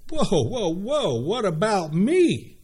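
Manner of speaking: high-pitched, self-important protest; selfish Pharisee. Acted. Pharisee worried about his own position, selfish high-pitched protest. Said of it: "They're a little bit selfish".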